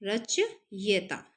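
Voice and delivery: रचयिता slowly split as rach, pause, yita; spelling out syllables. Shown as a demonstration. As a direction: break रचयिता in the middle, very slowly. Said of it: "रचयिता कैसे लिखा जाता है". (रचयिता को धीरे, तोड़कर)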